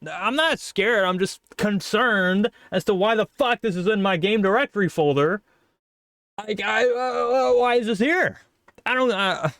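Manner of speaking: protest, getting rattled; defensive. Real, not acted. Defending against chat, rising panic.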